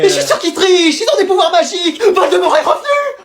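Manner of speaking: de plus en plus aigu, affolé; panique délirante. Acted. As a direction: crie au complot magique en partant. Surveillante paniquée emmenée. (surveillante affolée qui délire, voix très aiguë)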